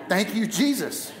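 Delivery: ordinary talk, nothing marked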